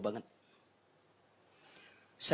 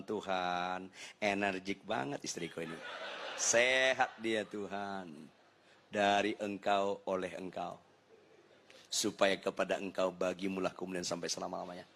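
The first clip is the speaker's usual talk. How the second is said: calm mock prayer; 6 semitones lower, 3 dB quieter than usual. Husband praying about his angry wife, calm and patient. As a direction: pray serenely in a low, measured voice.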